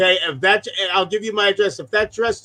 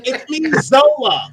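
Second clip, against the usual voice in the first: imitated reveal; 5 semitones higher than usual. Friend retells Zola revealing himself, imitated reveal. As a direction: draw out a mock grand reveal. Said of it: "Who the hell is this good-looking motherfucker?".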